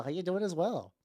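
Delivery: usual speaking voice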